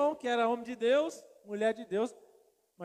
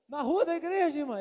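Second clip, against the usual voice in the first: higher, louder shocked question; alarmed disbelief; 5 semitones higher, 6 dB louder than usual. Retold or imitated. Pastor asking the sister if it was by the church, alarmed. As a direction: ask it aghast.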